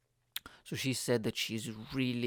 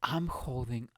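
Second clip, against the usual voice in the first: plain reading voice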